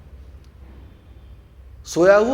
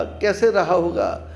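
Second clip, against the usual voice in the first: ordinary talk, nothing marked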